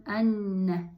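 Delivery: ان said "anna", opening on a short a; doubled N after zabar. Shown as a demonstration. Teacher reading alef with zabar and tashdid noon, ان as "anna". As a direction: hold the double n in ان: "an-na". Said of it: "الف زبر داره".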